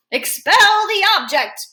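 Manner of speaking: loud, high-pitched command; shout. A narrator's reading. Freak pounding his choking friend on the back, shouting. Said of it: "Freak shouts".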